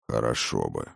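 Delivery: put-on grudging growl of an old dragon; through gritted teeth. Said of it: "сквозь зубы процедил старший дракон".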